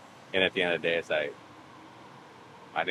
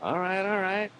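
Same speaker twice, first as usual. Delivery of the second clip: high-pitched retold "okay, okay"; high, acted backing-off; mock placating; slower than usual. Placating the impatient driver.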